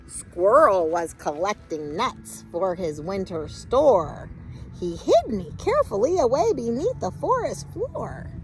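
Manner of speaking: ordinary delivery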